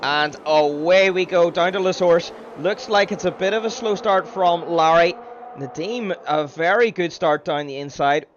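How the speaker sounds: loud live play-by-play; motorsport commentator. Acted. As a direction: call the race start with energy. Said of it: "I will do my best to commentate".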